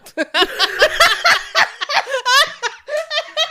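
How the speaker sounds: high, loud burst of laughter; shrieking laugh at the joke; loud laughing fit. Real, not acted. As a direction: cackle out loud.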